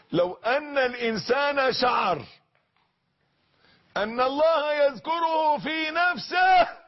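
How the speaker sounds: soaring sermon shout; preaching shout. Real, not acted. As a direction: call it out to the crowd with full force. Preacher cries out key point.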